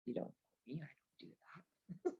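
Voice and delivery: hushed, teasing whisper; soft mock secret; whispered aside.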